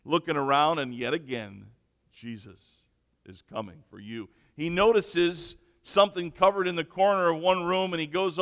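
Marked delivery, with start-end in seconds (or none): 2.1-4.3 low, soft warning; understated menace, pitched down and quiet; ominous unseen voice. Acted. Hidden voice warning a nervous burglar, softly ominous.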